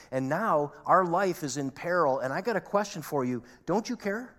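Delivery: acted angry, desperate protest, voice pushed up; panicked, frustrated. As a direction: confront the sleeper in rising frustration and alarm. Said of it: "saying in panic and in fear and in frustration".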